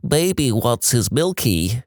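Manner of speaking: put-on slow, cooing baby talk. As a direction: talk like a whiny toddler. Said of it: "in a baby voice".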